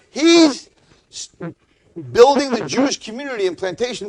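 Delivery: high, loud, disbelieving rush; can't believe the good news, loud and high; astonished excitement. Retold or imitated.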